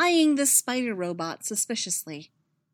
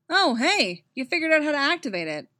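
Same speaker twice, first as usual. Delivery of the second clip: bright, quick, pleasantly surprised; sudden cheerful surprise; 7 semitones higher, 4 dB louder than usual. A narrator's reading. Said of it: "sounding surprised".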